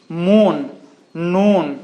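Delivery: "मून, नून" with long OO vowels. Shown as a demonstration. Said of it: "double O के साथ long". ("मून, नून" में लंबा ऊ)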